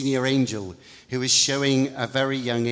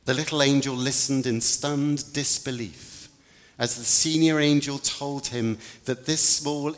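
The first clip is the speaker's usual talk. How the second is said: usual speaking voice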